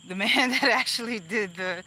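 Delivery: laughing through the words; breaking into laughter mid-sentence. Real, not acted. Laughing while retelling.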